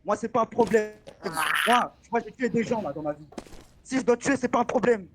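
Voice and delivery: menacing threat. Acted. Director brandishes gun, threatens trainee.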